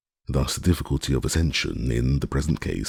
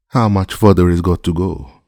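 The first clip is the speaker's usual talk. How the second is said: loud call from high in a tree; calling halloo; 4 semitones higher, 8 dB louder than usual. A narrator's reading. Servant calling down to master from the tree, loud halloo. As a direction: shout it down from the treetop. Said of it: "his voice was heard in a sort of halloo".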